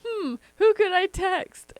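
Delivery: high, put-on musing; mock scheming mom. Daughter imitates mom choosing a prank victim, high musing. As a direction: wonder aloud with playful mock innocence.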